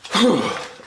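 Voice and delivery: long weary sigh. Real, not acted. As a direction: let out a bored sigh. After boring poem.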